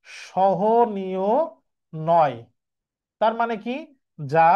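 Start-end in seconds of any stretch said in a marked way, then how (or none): 0.1-2.4 drawn-out সহনীয় then নয়; teacher spelling out the answer word by word; slow emphatic answer. Shown as a demonstration. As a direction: stretch সহনীয় slowly and land on নয়. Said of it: "তখন এটার অর্থ দাঁড়াবে কি?".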